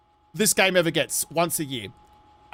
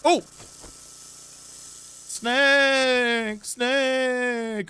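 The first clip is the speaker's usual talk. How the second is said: startled cry of Snake!, called out twice; startled snake alarm; 3 semitones higher, much slower than usual. Real, not acted. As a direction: shout the warning the moment you see it. Spots a snake outside.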